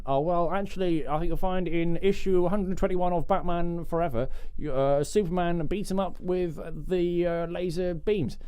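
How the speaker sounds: lightly voiced pedantic comic fan; a hint of a smug geek correcting; nerdy know-it-all. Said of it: "conversations overheard".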